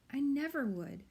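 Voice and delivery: high, loud, shocked gasp of protest. A narrator's reading. Firion protesting to Zan, high and shocked. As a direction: blurt it out wounded. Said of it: "Firion gasped".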